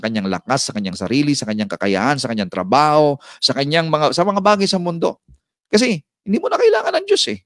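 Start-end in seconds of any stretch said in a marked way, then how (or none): 5.7-7.4 mock proud voice. Voicing self-reliant person.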